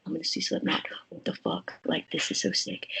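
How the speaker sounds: hushed, thrilled whisper; excited whisper. Retold or imitated. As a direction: whisper it like you can't believe it. Excited private thoughts before show.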